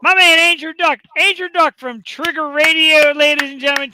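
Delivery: high, loud announcer-style introduction; hyped shout-out. Real, not acted. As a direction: hype up a friend to the crowd. Hyped shout-out to a comedy show.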